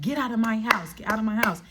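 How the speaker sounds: usual speaking voice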